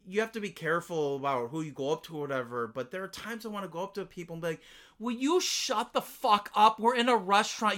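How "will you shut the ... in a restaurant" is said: loud, higher, emphatic scolding; acted angry confrontation. Diner imagining confronting the loud guy, fed up.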